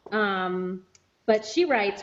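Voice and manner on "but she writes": usual speaking voice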